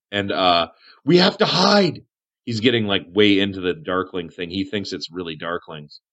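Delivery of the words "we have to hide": higher, mildly panicked hermit voice; scared. Acted. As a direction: urge them to hide, pitched up. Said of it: "He's getting pretty scared".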